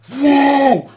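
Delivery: very high, loud scared whoa; panicked yell. Retold or imitated. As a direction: cry out in sheer fright. Friend bursts out of tree.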